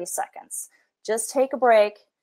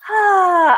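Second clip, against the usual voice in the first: anguished cry; 9 semitones higher, 8 dB louder than usual. Retold or imitated. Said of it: "instead of going like".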